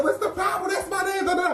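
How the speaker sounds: high, innocent voice, half laughing; mock innocent. To the cops about her fake name, high mock innocence. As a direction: play dumb with a giggle.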